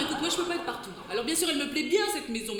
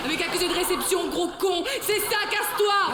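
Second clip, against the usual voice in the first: angry shouting; 4 semitones higher, 5 dB louder than usual. Acted. Couple quarrel, she yells back.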